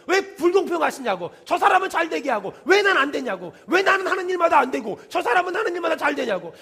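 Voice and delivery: escalating, insistent protest; mock indignant complaint. Sufferers complaining to God about unfairness, imitated indignant protest.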